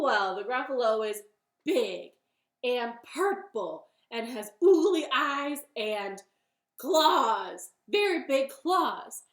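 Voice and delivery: loud, emphatic bunny; bunny character. Acted. Bunny describes Gruffalo to fox, loud and emphatic. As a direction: build up the monster item by item. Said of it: "Bunny said".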